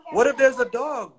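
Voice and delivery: lightly acted worried whimper, pitched high; John John whimpering. A narrator's reading. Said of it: "whimpered John John".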